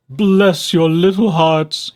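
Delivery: slow, loud fox voice crying out. A narrator's reading. Fox on his knees thanking the DeSotos, crying out. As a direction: cry out gratefully. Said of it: "he cried".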